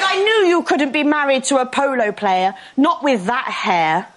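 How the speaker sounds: put-on condescending posh British accent; very posh woman. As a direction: deliver a put-down in painfully posh English. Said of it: "One of those people so posh".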